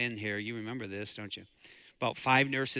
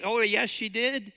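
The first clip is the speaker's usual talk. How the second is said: normal talking voice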